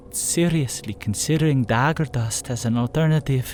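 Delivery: put-on frail, high, weary northern girl; frail Peona. A narrator's reading. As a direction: answer tiredly in the northern accent. Peona voiced in dialogue.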